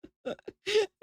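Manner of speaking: bursting giggle at herself; high laugh. Real, not acted. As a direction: laugh at your own slap. Laughs after smacking self.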